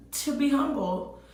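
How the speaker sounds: ordinary talk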